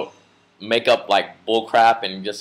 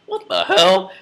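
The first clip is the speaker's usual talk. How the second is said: shocked; 9 semitones higher than usual. Retold or imitated. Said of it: "and I'm like".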